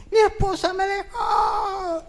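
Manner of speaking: put-on young man sobbing to the pastor that his wife left; crying wail. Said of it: "viene el muchacho llorando".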